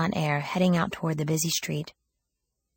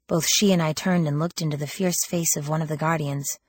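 usual speaking voice

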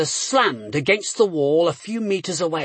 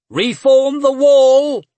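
loud, high, slow battle order; shouted command; 8 semitones higher, 9 dB louder, slower than usual. A narrator's reading. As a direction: bellow it across the field. Officer rallies his men.

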